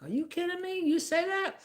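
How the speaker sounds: high, incredulous challenge; outraged disbelief. Retold or imitated. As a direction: shoot it back high in disbelief. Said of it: "I was like".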